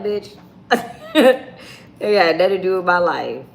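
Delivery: laughing through a sassy retort. Retold or imitated. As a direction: burst out laughing mid-comeback. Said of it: "I was like".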